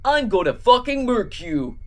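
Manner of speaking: mild mock scream; half-hearted screamed threat; Cat in the Hat. A narrator's reading. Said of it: "the Cat in the Hat screamed".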